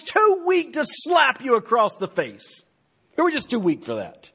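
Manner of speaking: mocking sarcasm, rising high and loud; sarcastic. Shown as a demonstration. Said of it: "Now this is sarcasm at its finest".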